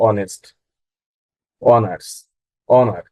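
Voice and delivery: অনেস্ট, অনার্স, অনার with no H sound; silent H. Shown as a demonstration. Teacher reads out example words, silent H. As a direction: begin অনেস্ট, অনার্স, অনার on the open vowel. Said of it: "silent থাকে".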